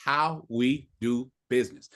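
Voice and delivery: slow, deliberate demonstration of a set line; one firm word at a time; measured, very slow statement of policy; just like that. Shown as a demonstration. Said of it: "I say it just like that".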